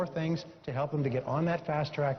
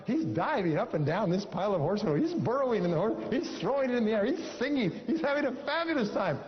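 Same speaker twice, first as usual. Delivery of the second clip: rising higher and higher with glee; building delight; 7 semitones higher than usual. A narrator's reading. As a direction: climb the pitch as the joy builds. Narrating Johnny's joyful romp.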